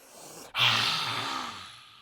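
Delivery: long, forceful breathy sigh; big released exhale. Shown as a demonstration. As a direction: let all the air rush out. Demonstrates untamed exhale.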